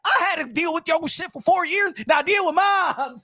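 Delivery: high, teasing mock voice; mock taunting reply. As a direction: taunt the angry fans back. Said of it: "It's like".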